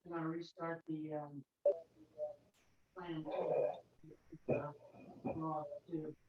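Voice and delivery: distant, off-mic voice; speaking from across the room; from another room. Real, not acted. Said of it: "I'm in the other room".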